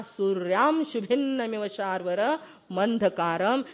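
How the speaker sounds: "सूर्याम शुभिन्नमिव शार्वर मंधकारम" with a clear nasal m closing सूर्याम; careful spoken "सूर्याम", nasal ending clearly heard; correct anusvara. Shown as a demonstration. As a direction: sound the m in "सूर्याम" before शुभिन्नमिव. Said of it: "सूर्या के ऊपर अनुस्वार है".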